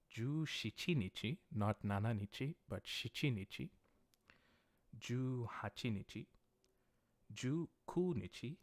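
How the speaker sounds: usual speaking voice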